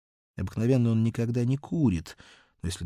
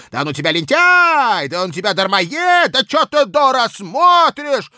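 put-on loud angry yelling at the old woman; shouting; 14 dB louder than usual. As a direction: bellow it like a furious boss. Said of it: "как закричит".

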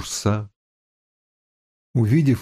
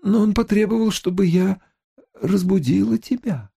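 put-on girl voice; 9 semitones higher than usual. A narrator's reading. Slave girl speaks.

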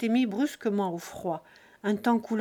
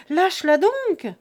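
put-on loud, high warning yell to the girl; shout; 9 semitones higher, 10 dB louder than usual. A narrator's reading. Jean yelling at the girl to let go of the cow, loud alarmed shout. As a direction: bellow it across the field in alarm. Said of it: "Il cria".

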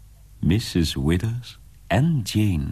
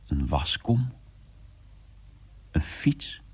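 usual speaking voice

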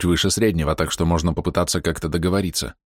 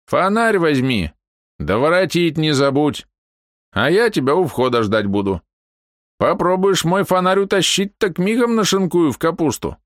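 put-on old man; 10 semitones higher, 3 dB louder than usual. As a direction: threaten like a cranky old man. Old man gives orders, threatens.

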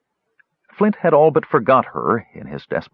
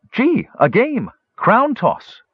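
much higher, slow, delighted gully dwarf squeal; gleeful gully dwarf; 14 semitones higher, slower than usual. Acted. Numskull thrilled at tossing the crown, squealing high.

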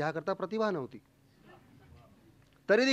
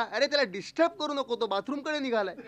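mock yell; 6 semitones higher than usual. Engrossed neighbour tells others not to interrupt, mock yelling. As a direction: imitate an absorbed listener crying out. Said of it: "तो एकदम ओरडला".

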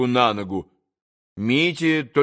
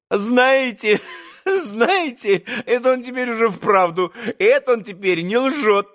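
higher, laughing through the words; laughing exclamation; 7 semitones higher than usual. A narrator's reading. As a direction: blurt it out between laughs. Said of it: "восклицал, обращаясь к Мите".

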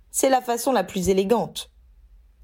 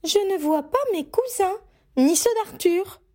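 high, fussing mother's voice; a mother checking the list; Molly's voice; 12 semitones higher than usual. Acted. Mother notices missing cousins on the list, high and fussing.

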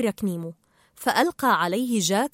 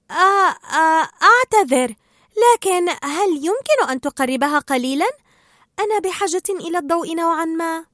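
higher, louder hesitant start, then polite asking; Dory, scatterbrained fish, apologetic; 5 semitones higher, 4 dB louder than usual. Acted. Dory asks Marlin for light, hesitant apology. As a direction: stammer an apology, then ask nicely. Dory's figure voice in story.

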